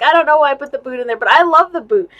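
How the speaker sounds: fast, loud, bubbly imitation of her friend; gushing friend quote. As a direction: rush it out like the excited friend who made it. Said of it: "She's like".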